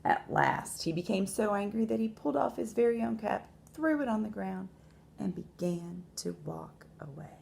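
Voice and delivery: measured storytelling with long pauses; expressive picture-book narration; story read to children. A narrator's reading. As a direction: read aloud to young listeners, stressing key words.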